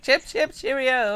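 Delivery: high, hammed-up British accent; mock British accent. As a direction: play a silly British toff, chip chip cheerio. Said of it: "exactly what he sounds like".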